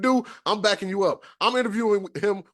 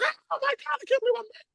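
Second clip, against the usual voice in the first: shrill high squeal of disbelief; mock fangirl scream. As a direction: squeal it like an overexcited fan. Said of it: "screaming like a little girl".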